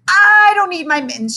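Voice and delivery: croaking. A narrator's reading. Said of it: "she croaked".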